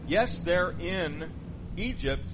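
normal talking voice

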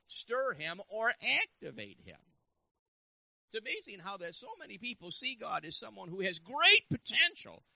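high, loud, driving sermon voice; forceful preaching. Real, not acted. Preacher testifies about entering into a miracle, forceful high proclamation.

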